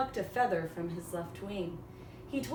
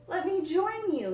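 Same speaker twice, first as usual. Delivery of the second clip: high, eager pleading; Coyote voice; 11 semitones higher, 5 dB louder than usual. Acted. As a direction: beg to join, pitched way up. Coyote begging the crows.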